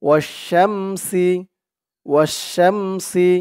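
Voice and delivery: slow drawn-out ওয়াশ-শামসি; slow model word. Shown as a demonstration. Teacher modelling the word for students, slowly. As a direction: stretch ওয়াশ-শামসি syllable by syllable. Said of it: "উচ্চারণগুলো খেয়াল করবেন".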